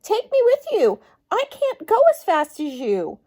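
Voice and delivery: high, loud calls to be taken along; frog pleading loudly. Acted. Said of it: "cried the frog".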